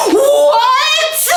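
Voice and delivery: very high, loud what; shocked shriek. Real, not acted. Seeing the bottom book, shrieking in surprise. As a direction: shriek it in delight.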